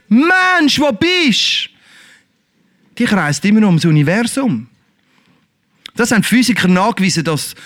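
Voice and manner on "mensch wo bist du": high, distant-sounding call; God's voice calling. Acted. God's voice calling mankind across the universe. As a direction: call from far away, high. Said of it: "die Stimme von Gott, die ruft".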